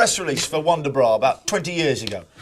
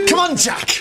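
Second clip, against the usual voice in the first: put-on Welsh lass accent; 11 semitones higher than usual. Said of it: "us Welsh lasses".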